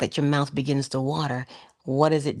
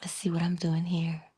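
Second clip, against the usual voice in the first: curious whisper; 3 semitones higher than usual. Pretend. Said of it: "if you just say".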